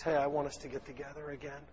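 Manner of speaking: quiet, gentle making up; soft reconciliation. Pretend. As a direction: softly offer friendship back. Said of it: "something that indicates".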